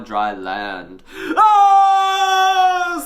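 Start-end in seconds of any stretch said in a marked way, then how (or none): 1.4-3.0 long, very high, loud scream; terrified scream. A narrator's reading. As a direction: scream at full pitch in horror. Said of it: "screamed Raj".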